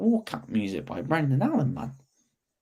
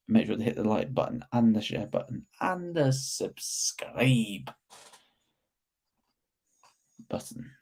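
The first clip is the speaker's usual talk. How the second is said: whispering; 4 dB quieter than usual. Real, not acted. Whispered plea to subscribe.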